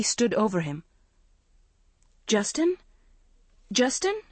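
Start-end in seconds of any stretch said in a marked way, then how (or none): 2.3-4.2 high worried calls of his name; anxious calling. A narrator's reading. Girl calling her collapsed boyfriend, worried and rising. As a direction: call him twice, rising and uneasy.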